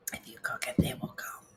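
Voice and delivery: breathy whisper of a movie line. Pretend. Quoting the baseball movie, whispered mysteriously.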